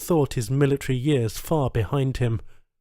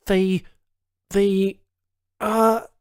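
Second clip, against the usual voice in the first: higher, halting groping voice; struggling for words; 7 semitones higher, much slower than usual. A narrator's reading. Charles struggling to recall a name, halting. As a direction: grope for the word. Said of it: "he struggled".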